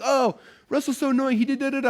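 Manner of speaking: high, whiny mock of a moaning customer; mocking complainer. Coach mimicking annoyed group members, high and whiny. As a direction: sneer it like a petty complainer. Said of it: "Drives me nuts when people".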